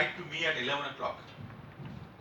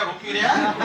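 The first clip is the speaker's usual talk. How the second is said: loud laughing Punjabi-style quote; 4 semitones higher, 8 dB louder, faster than usual. Retold or imitated. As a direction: burst out laughing mid-quote. Mock Punjabi quote dissolving into laughter. (हँसते हुए ज़ोर से पंजाबी लहजे में)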